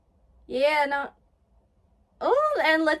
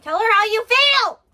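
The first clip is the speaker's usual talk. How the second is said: rising, loud urging cry; urgent yell; 6 semitones higher, 10 dB louder than usual. Real, not acted. Viewer urging him to tell her his feelings, urgent yell. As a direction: yell it at them, building to the end.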